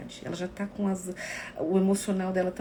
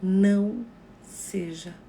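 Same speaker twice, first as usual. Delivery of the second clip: very slow, loud "Não seja"; slow emphatic advice; 7 dB louder, much slower than usual. Shown as a demonstration. Teaching viewers what to tell a grieving friend, slow and loud. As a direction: draw out each word gently. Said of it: "diga pra ela".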